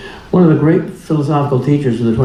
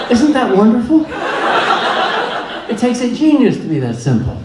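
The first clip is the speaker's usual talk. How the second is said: laughing; 9 semitones higher than usual. Real, not acted. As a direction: speak through a delighted laugh, higher. Laughing after Aristotle quote.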